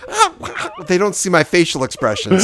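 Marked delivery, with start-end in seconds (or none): 0.1-0.7 Mickey Rooney caricature. Acted.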